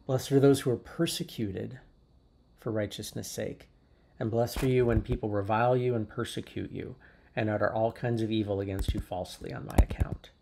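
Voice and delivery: usual speaking voice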